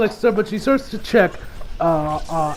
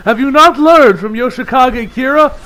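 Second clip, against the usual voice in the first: loud, slow gloating taunt half laughing; mocking yell; 3 semitones higher, 7 dB louder, slower than usual. Narrator jeering loudly at the doomed hopeful character. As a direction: jeer at the fool through laughter.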